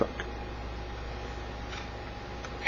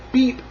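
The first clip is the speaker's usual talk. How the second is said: high, short chirpy beep; truck horn beep; 9 semitones higher than usual. A narrator's reading. Blue greets the toad, high short beep. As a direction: give a quick high-pitched beep. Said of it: "said Blue".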